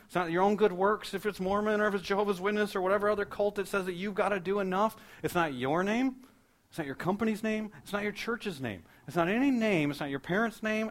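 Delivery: high, loud, driving list of denials; impassioned preaching. Real, not acted. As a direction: preach it forcefully, hammering each NOT.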